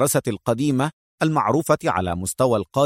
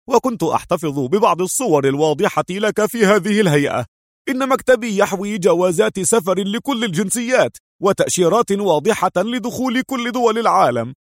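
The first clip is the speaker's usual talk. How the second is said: higher-voiced forger explaining his easy craft; nonchalant forger friend; 6 semitones higher than usual. Forger telling his friend how simple the job was, higher and nonchalant. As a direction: make light of your own skill, voice lifting as it goes.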